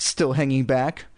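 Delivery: ordinary delivery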